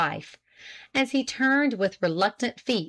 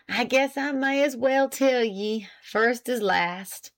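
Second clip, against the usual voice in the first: higher, lightly voiced country husband, despairing; stubborn despair; 3 semitones higher than usual. A narrator's reading. Husband confessing to his wife, stubborn despair. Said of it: "in a sort of stubborn despair".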